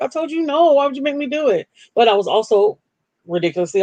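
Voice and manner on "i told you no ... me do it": high, wounded mock protest; high, hurt "I told you no". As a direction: whine it like you're hurt. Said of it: "it hurt my feelings first".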